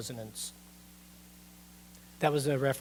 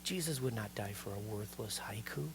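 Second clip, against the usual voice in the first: whisper; 7 semitones lower, 10 dB quieter than usual. Real, not acted. Hushed aside to congregation.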